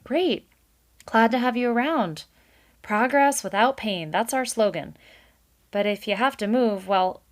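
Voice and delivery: high, loud cheerful brush-off; breezy boss beaver. A narrator's reading. Mister Kanad sends Valerian off, loud cheery goodbye. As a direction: wave him goodbye like a salesman.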